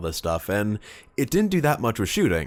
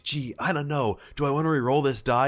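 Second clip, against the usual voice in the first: mock indecision; 7 semitones higher than usual. Mocking opponent's fake indecision.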